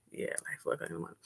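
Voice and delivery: a hushed, quick confiding whisper; whispered aside. Real, not acted. Repeating the late hour to the audience, whispered.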